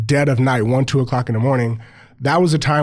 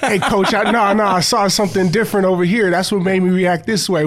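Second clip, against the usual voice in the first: higher-pitched, hurried-sounding mock protest; pleading excuse; 6 semitones higher than usual. Player making excuses to coach in film meeting, mock pleading. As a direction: plead your case in a raised voice.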